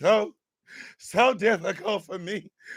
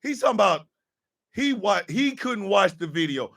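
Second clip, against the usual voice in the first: usual speaking voice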